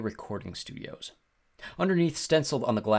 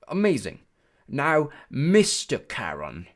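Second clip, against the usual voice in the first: loud, higher British voice, prompting; patronising British praise; British-like accent; 5 semitones higher, 6 dB louder, slower than usual. A narrator's reading. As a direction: coach them slowly in a British accent. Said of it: "He had a strange accent. British maybe".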